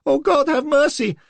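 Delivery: very high, loud terrified squeal. A narrator's reading. Little man squealing his excuse at Lola, very high and terrified. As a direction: squeal in pure panic. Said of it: "His voice went up to a squeal".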